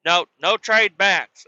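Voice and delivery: slow, high, loud no trade backs; scolding mom. Retold or imitated. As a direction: wag your finger while saying it. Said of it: "pointing and waving her finger".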